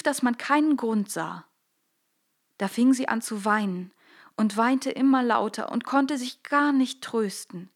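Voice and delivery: plain reading voice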